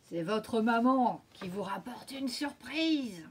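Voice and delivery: a big bad wolf pretending to be mum; hoarse wolf voice. A narrator's reading. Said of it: "alors que la tienne est tout enrouée".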